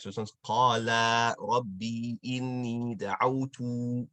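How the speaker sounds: slow, every sound of qala rabbi inni da'awtu dragged out. Shown as a demonstration. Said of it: "most of the sounds are dragged".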